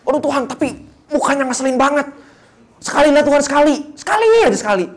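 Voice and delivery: high, loud, pleading whine; very high, loud comic pleading "sekali"; hammed-up begging. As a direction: beg God like a spoilt child for one punch. Begging God to hit once.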